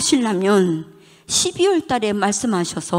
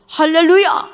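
hallelujah shout; 9 semitones higher, 7 dB louder than usual. Real, not acted.

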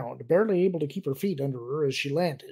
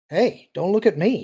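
raised, louder protest; 5 semitones higher, 6 dB louder than usual. A narrator's reading. Luke denies his part to Mara, protesting. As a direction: push the blame away with higher pitch. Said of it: "he protested".

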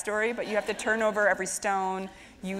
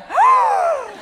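a loud, very high mock gasp; kids' gasp; 13 dB louder than usual. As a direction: gasp like shocked children. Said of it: "And they were like".

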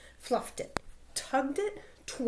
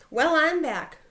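growled; 3 semitones higher, 7 dB louder than usual. Acted. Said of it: "growled Big Bark".

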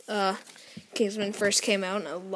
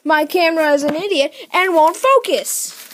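high, very loud frustrated rant; exasperated yell; 7 semitones higher, 11 dB louder than usual. Real, not acted. Kid complaining to viewers that the camera fails, exasperated yell. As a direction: yell in exasperation at useless equipment.

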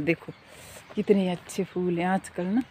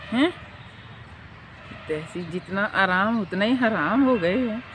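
usual speaking voice

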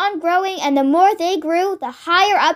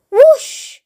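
loud, high, sudden whoosh; chicken's shriek; 10 semitones higher, 6 dB louder than usual. A narrator's reading. Chicken just fed the medicine, shrieked high and loud. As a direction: shriek it out in one startled burst. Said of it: "shrieked the chicken".

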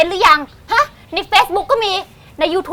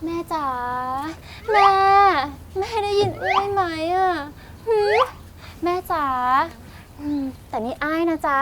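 hushed, pleading call to mother; shrill melodramatic sobbing; 9 dB quieter than usual. Shown as a demonstration. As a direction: softly beg mother to answer. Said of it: "ฉันจะคุยกับแม่ให้ดู".

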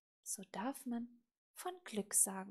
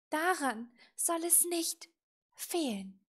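loud, starting high and coming down; firm promise; 6 semitones higher, 6 dB louder than usual. A narrator's reading. Little witch promising raven to do good, firm. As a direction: promise it with emphatic resolve.

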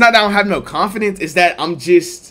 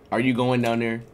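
normal talking voice

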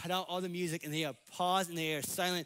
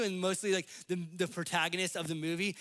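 normal talking voice

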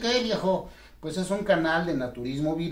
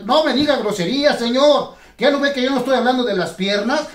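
high, loud indignant scold; loud mock scolding; 7 semitones higher, 7 dB louder than usual. To the dummy Dani, a loud high indignant scold. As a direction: scold him sharply, pitched up.

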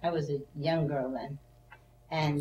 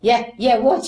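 louder, higher urgent demand; impatient yell; 5 semitones higher, 10 dB louder than usual. Retold or imitated. Mother yelling at her daughter to explain, loud impatient demand. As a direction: snap at her to spit it out. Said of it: "She's yelling at me".

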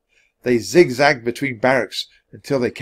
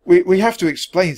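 raised, halting, out of breath; breathless; 8 semitones higher, 6 dB louder than usual. A narrator's reading. Jack to Annie after fleeing, breathless. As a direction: stammer it after running. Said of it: "said Jack breathlessly".

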